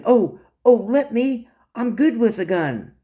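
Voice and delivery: high, eager jump-in; excited, boyish offer; eager volunteering. Acted. Ronnie jumps in, volunteering.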